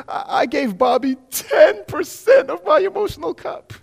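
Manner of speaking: high, hammed-up tearful whine; mock hurt girlfriend. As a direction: mimic a wounded girl, teary.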